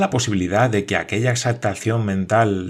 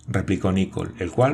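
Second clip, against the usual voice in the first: ordinary delivery, nothing marked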